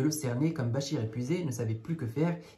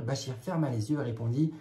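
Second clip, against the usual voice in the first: plain reading voice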